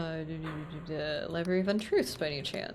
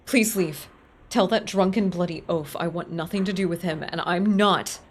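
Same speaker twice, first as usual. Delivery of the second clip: louder, indignant dismissal; furious librarian telling him to go; angry librarian; 4 dB louder than usual. Acted.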